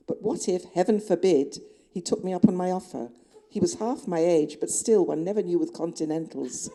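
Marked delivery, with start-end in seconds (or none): none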